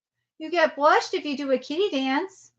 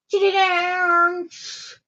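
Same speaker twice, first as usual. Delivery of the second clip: very slow, stretched-out announcement; drawn out; much slower than usual. Real, not acted. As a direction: stretch every syllable. Announcing the kitty dance.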